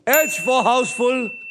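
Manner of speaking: slow, drawn-out game show call; booming prize announcer. Acted. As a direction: announce each word slowly. Host repeats letter slowly.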